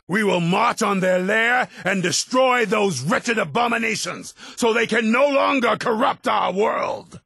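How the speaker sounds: high, soaring call to arms; impassioned high battle oration; rallying speech. Acted. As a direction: fire up the army for the march. Leader rallying for an assault.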